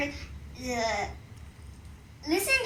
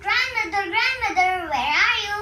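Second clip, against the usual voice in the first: higher, louder calling for grandmother; 7 semitones higher, 4 dB louder than usual. Acted. Girl calling through the empty house for her grandmother, loud and high. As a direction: call out through the house, anxious. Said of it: "went in and yelled".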